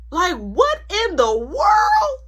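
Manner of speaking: high slow loud disbelief; baffled after mom walks off. Real, not acted. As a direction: stretch it out, utterly baffled.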